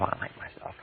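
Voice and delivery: whispered; barely whispered under your breath; said to yourself. Shown as a demonstration. Teacher contrasting silent self-talk: whispered. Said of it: "If you say to yourself".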